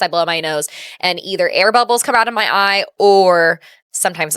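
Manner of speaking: normal talking voice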